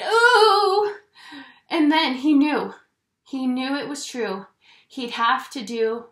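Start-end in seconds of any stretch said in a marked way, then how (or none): 0.0-1.0 high anxious ooh; panicked ooh. A narrator's reading. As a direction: let out a worried cry. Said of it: "and Lou cried".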